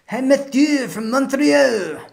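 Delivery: high, slow, hammed-up French accent; butchered French accent. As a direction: go over the top with a bad French accent. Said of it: "this is probably my butchered French accent".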